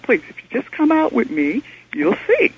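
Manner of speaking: retold plea that climbs steeply in pitch; retold urgent pleading. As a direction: beg him urgently to step outside. Begging the clerk to come outside.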